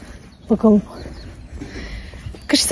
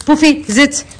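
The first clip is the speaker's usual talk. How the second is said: higher, firm call of sit to the dog; high sit command; 6 semitones higher than usual. Real, not acted. To her dog Pufi, a higher firm sit command. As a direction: tell the dog to sit, pitched up.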